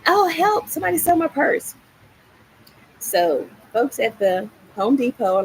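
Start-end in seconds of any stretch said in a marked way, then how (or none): 0.1-1.7 much higher, fast panicked cry; frantic plea for help. Retold or imitated. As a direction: call for help as if the world is ending. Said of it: "I was frantic".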